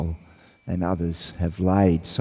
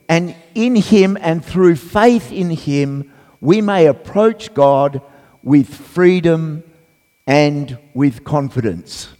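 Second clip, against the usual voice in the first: raised, emphatic public Bible reading; scripture reading; 8 semitones higher, 7 dB louder than usual. Real, not acted. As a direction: proclaim the passage to the whole congregation, pitched up.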